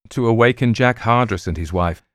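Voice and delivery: ordinary delivery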